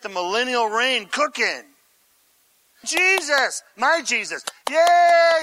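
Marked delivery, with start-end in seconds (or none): 2.8-5.4 acted fan cheer, rising to a loud, high yay; hammed-up jubilation; cheering crowd member. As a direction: cheer like an adoring supporter. Voices the adoring, cheering crowd.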